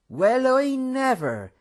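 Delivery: slow, high and loud delighted surprise; exclaimed. A narrator's reading. Host greets a lost friend at the door, slow surprised exclamation. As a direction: cry out in happy disbelief, slowly. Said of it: "he exclaimed".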